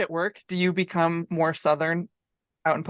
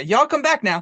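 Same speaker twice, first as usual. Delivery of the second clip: put-on Southern accent; 8 semitones higher, 7 dB louder than usual. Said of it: "you say".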